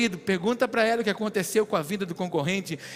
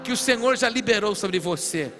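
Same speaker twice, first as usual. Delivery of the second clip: loud, rising prophetic proclamation; rousing, forceful declaration with stretched phrases; fervent preaching; 5 semitones higher, 8 dB louder than usual. Real, not acted. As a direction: preach at full force to the congregation.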